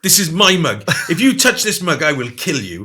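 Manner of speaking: half-hammed possessive warning; mimicking a fierce patient. Imitating the woman at the Priory claiming her mug, mock threatening. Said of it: "She goes".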